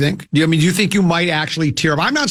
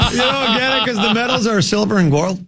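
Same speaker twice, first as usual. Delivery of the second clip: laughing explanation; 6 semitones higher than usual. Real, not acted. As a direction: explain the joke through laughter, voice raised. Explains joke while laughing.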